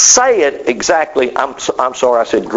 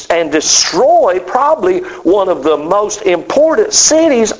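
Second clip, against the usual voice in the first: fired-up preaching; 7 semitones higher than usual. Real, not acted. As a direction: build the outrage question by question. Rising rhetorical questions, sermon climax.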